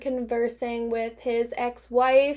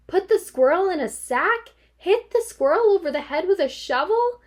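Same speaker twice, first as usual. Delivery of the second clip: high, louder squeaky disbelief; father squeaking in horror; squeaky, despairing; 8 semitones higher, 4 dB louder than usual. Acted. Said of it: "in a squeaky, despairing voice".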